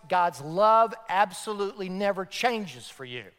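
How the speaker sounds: ordinary delivery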